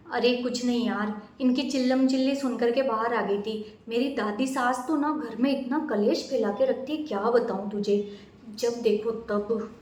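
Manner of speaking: low, soft confiding aside; softly complaining to a neighbour; quiet aside. Acted. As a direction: murmur it so the in-laws don't hear. Daughter-in-law confides to neighbour.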